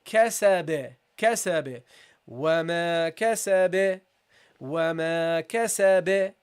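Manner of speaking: "kasabe" with the final b bounced; measured recitation, bouncing the end of "kasabe"; qalqalah bounce. Shown as a demonstration. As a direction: model "kasabe", releasing the b in a short echo. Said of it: "you have to give it a bounce".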